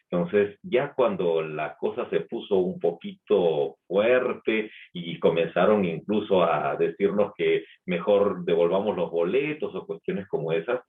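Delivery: normal talking voice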